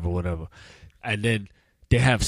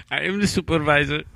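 a touch of higher mock Indian accent; mock call-centre accent; 5 semitones higher than usual. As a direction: join the bit as the supervisor. Friend joins the mock call.

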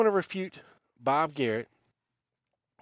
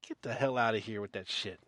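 ordinary talk

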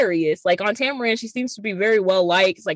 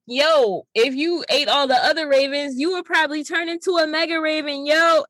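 put-on Beast Boy voice; 7 semitones higher than usual. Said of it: "Beast Boy is like".

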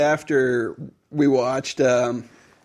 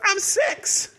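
put-on very high little girl voice; very high child's voice; tiny girl. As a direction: squeak it like a six-year-old. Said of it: "she's like".

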